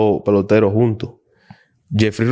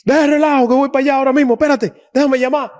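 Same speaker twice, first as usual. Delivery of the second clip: excited rush; 14 semitones higher, faster than usual. Retold or imitated. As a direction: retell the friend bursting with excitement. Friend excited after the news.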